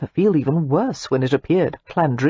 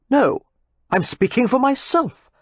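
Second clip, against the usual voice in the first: high, earnest insistence; earnest protest; 8 semitones higher than usual. A narrator's reading. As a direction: insist he means it himself.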